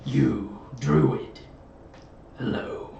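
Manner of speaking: mysterious presence, halting, with long pauses; voice in the head. Acted. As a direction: address the druid as an unseen presence. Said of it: "hear a voice that just goes".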